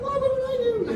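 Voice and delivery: high-pitched imitation of a confused wife; bewildered wife. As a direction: squeak it in puzzled innocence.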